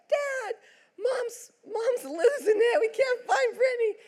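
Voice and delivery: put-on high, breathless panicked kids' voices; excited kids. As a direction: blurt the news as frantic little boys. Boys run out to Dad.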